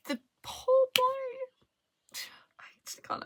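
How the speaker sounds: drawn-out, high lament, fading to a whisper; close to crying. Real, not acted. Reader grieving over a book character, close to crying. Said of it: "I'm gonna cry thinking about him".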